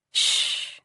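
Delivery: unvoiced, soft shush to calm him; soothing shush. She hushes her crying partner, soft soothing shush. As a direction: hush him gently. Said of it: "trying to calm him down".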